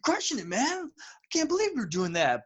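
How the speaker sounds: put-on high-pitched, amazed young dude; thrilled, disbelieving mock reaction; excited younger self. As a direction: gush in awe as your younger self. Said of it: "twenty-year-old us were looking at ourselves".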